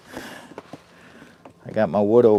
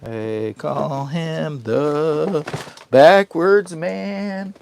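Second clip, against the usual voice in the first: slow, much higher, rising to loud; drawn-out announcer call; 8 semitones higher, 4 dB louder, much slower than usual. Pretend. Announcing his backwards-man nickname as a slow showman. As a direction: stretch it like a showman's intro.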